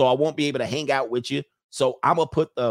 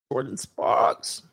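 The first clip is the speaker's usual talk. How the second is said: whispered slow name; much slower than usual. Shown as a demonstration. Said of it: "You said [name]?".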